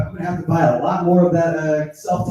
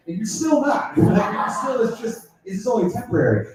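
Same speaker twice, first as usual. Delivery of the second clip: high, emphatic joking protest; mock shout; 6 semitones higher than usual.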